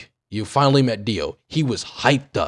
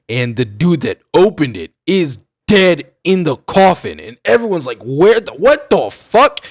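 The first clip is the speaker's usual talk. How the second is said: building shock; 3 semitones higher, 6 dB louder than usual. Real, not acted. Fan revealing who is in the coffin, building to loud shock. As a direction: build to stunned disbelief. Climax of scary part retold.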